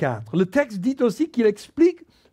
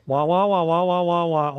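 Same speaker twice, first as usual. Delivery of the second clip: muffled wah wah talk; 4 semitones lower than usual. Retold or imitated. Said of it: "c'est comme".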